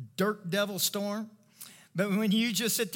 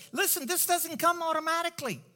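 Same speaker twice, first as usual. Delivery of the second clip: insistent; 11 semitones higher, 5 dB louder than usual. Real, not acted. Urgent correction to congregation.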